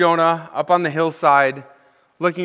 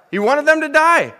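emphatic reveal; 11 semitones higher, 4 dB louder than usual. Real, not acted. Revealing answer to his question.

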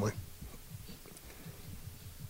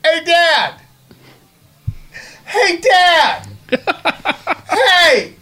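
put-on high, nagging child calling dad; whiny kid voice; 9 semitones higher, much slower than usual. Kid pestering his dad during the movie, whiny. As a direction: whine it like a bored kid. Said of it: "the kid's going".

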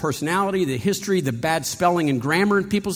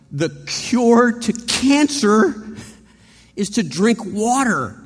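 "The cure to cancer" drawn out slowly and higher; careful, slow, weighty; 4 semitones higher, 3 dB louder, slower than usual. Retold or imitated. David passes on the message with slow careful precision. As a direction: spell out each word so nobody gets prodded. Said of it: "he says".

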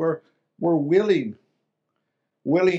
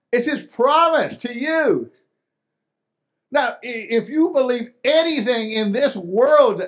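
louder and higher, pleading; fervent preaching; 5 semitones higher, 4 dB louder than usual. Real, not acted. Pastor pleads with the congregation to believe, louder and higher. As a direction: preach it with rising urgency.

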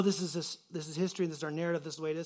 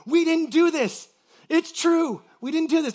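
higher, louder and slow, proud of innocence; confident declaration; 9 semitones higher, 11 dB louder than usual. Retold or imitated. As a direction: say it boldly and slowly, chest out. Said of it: "feeling confident".

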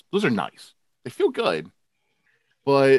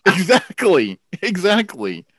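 laughing; 9 semitones higher, 5 dB louder, slower than usual. Real, not acted. Laughing agreement after a joke.

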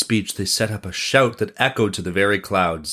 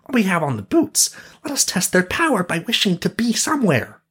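high-pitched sidekick voice; high storybook character; Sauron; 10 semitones higher than usual. A narrator's reading. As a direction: propose an adventure, high and lifted. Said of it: "said Sauron".

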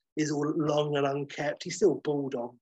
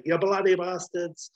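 loud hammed-up warrior yell; playful mock battle cry; 4 semitones higher, 4 dB louder than usual. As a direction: roar it while firing away. Baron shooting people in fight.

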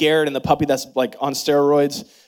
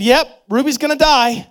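higher, louder mock-blunt dad; 10 semitones higher, 5 dB louder than usual. Dad bluntly telling his crying kids the dog will die, mock harsh. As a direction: toss it off with careless bluntness. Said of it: "You don't say that to a three-year-old".

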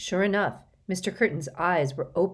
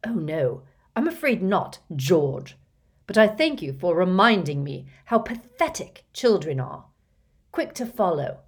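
mocking, louder villain voice with rising sneers; scornful villain; 2 semitones higher, 5 dB louder than usual. A narrator's reading. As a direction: belittle the children with contempt. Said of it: "laughed a terrifying screech owl laugh".

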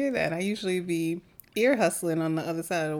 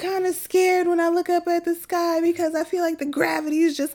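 imitating scared child; 12 semitones higher, 5 dB louder than usual. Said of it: "he said".

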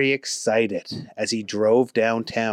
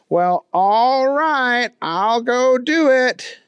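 high, slow goofy drawl; slow agreeable dimwit; Booger voice; 11 semitones higher, 3 dB louder, slower than usual. A narrator's reading.

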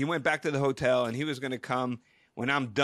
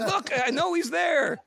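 high, drawn-out exasperated plea; exasperated insistence; 13 semitones higher, 3 dB louder than usual. Retold or imitated. Guest pushes back at receptionist, high and exasperated. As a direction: insist slowly, pitch way up.

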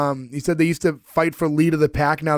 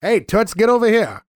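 put-on higher, brash old-timey catcall; 5 semitones higher than usual. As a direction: bark it like an old-timey wiseguy. Old-timey catcall after prompt.

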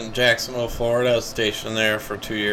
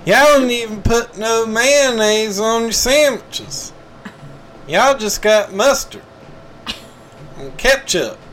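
hammed-up Southern accent; 10 semitones higher, 4 dB louder than usual. NASCAR fan lost at a golf tournament, loud high Southern accent. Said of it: "you doing your southern accent".

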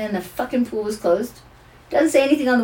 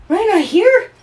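put-on high, louder startled child question; child's surprised question; 11 semitones higher, 6 dB louder, slower than usual. Granddaughter learning Ryan left, high startled question. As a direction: echo it back like a stunned toddler.

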